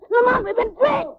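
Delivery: high, loud cry of warning; shouted alarm, high and urgent. Acted. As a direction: yell the warning to your partner.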